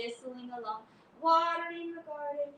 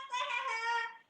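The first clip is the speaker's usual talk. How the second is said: high teasing cackle; mocking laugh; 10 semitones higher than usual. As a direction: laugh at him. Brother laughing.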